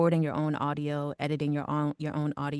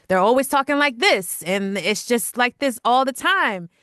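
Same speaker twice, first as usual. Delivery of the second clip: put-on radio announcer, higher and louder; 7 semitones higher, 9 dB louder than usual. Shown as a demonstration. Guest mimicking people who always use radio voice, loud and projected. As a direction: project it like an exaggerated radio host. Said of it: "what they call the radio voice".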